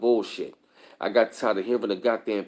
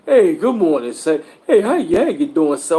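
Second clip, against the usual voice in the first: mock cheery greeting; 4 semitones higher, 6 dB louder than usual. Imitating a brother's greeting.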